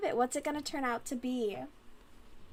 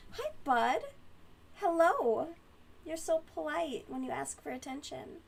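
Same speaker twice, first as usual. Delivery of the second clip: pet talk; 5 semitones higher than usual. Real, not acted. Talking sweetly to her cat.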